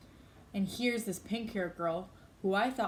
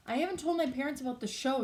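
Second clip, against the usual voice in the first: quick, higher-pitched confession; quickly; 6 semitones higher, faster than usual. A narrator's reading. Olivia confesses to Justin about the show, quickly. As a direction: blurt it out fast. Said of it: "she said quickly".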